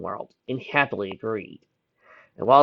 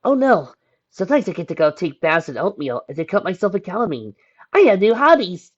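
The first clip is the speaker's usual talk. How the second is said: high, mock-cheerful sarcasm building louder; snarky; 7 semitones higher, 6 dB louder than usual. A narrator's reading. Bedridden teen griping to her friend by phone, snarky. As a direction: snark it, rising to a sarcastic flourish. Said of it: "the bedridden teen snarked over the line".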